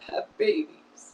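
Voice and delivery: breaks into a high, slow, tearful whisper; the words barely come out through tears; choked whisper on the last words; voice breaking. Real, not acted. Grieving mother, voice breaks.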